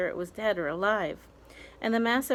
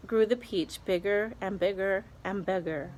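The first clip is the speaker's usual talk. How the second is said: usual speaking voice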